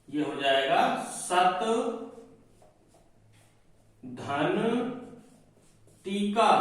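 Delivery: सत धन टीका said in separated chunks with pauses; split into parts. Shown as a demonstration. Teacher splitting सत धन टीका syllable by syllable. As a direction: break the word into सत, धन, टीका. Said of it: "यानी ता लगना ही है".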